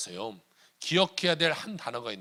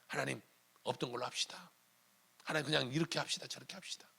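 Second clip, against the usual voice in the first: soft, lowered mock negotiation; wheedling bargaining; 9 dB quieter than usual. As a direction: quietly propose a deal to God. Acted bargaining with God. (낮은 소리로 슬쩍 흥정하듯)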